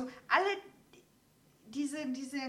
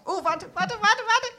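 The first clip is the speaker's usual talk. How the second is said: panicky calling out; 8 semitones higher, 7 dB louder than usual. Retold or imitated. Retold fear while riding a horse.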